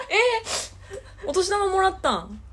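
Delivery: high, slow and amazed; can't believe what she just heard; surprised. Real, not acted. Hearing her friend got New Year money at 32, amazed.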